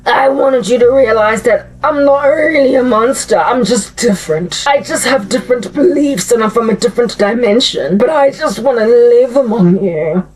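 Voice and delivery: slow, oily, hammed-up monster pleading; slow slimy Freddy Krueger playing misunderstood; mock sincere Freddy. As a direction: purr like a creepy monster wanting sympathy. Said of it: "And Freddy's like".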